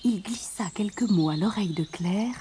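soft, breathy, secretive storytelling; whispered narration. A narrator's reading. Prince confides in Claire, whispered narration. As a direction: whisper it as if into an ear.

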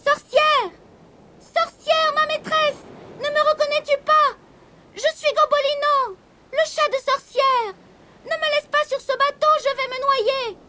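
kitten's very high pleading cry. A narrator's reading. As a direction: call out desperately in a tiny kitten voice. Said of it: "qu'ils l'entendirent crier".

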